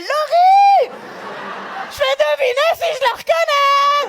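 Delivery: shrieked high and loud, overjoyed; high excited shriek. Retold or imitated. A girl from the toilets recognising her, screaming with excitement. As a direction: squeal her name like a thrilled fan.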